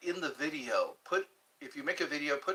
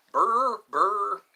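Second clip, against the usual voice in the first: odd loud voice; 7 semitones higher, 9 dB louder than usual. Pretend. Mock voice, loud strange.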